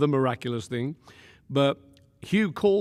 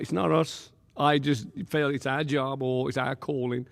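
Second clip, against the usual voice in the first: ordinary talk, nothing marked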